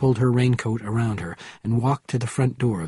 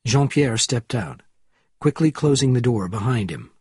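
plain reading voice

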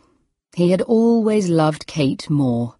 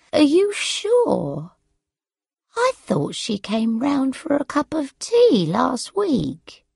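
put-on high-pitched old mother, sweeping intonation; confused, doubting mother; 7 semitones higher, slower than usual. A narrator's reading. Forgetful mother doubts what her daughter says, confused.

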